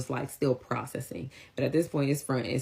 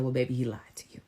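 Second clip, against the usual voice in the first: whispering; faster than usual. Retold or imitated. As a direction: breathe it gently to her. Gently telling the girl.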